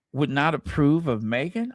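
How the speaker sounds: ordinary delivery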